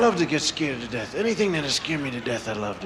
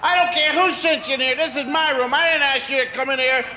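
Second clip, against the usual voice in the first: put-on yelling child; 14 semitones higher than usual. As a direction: order the snakes out of the room. Child yells at invisible snakes.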